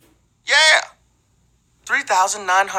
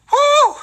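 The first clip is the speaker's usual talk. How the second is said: high whoop; 4 dB louder than usual. Acted.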